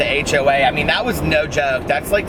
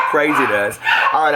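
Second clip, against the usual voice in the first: ordinary delivery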